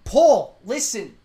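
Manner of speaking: ordinary talk, nothing marked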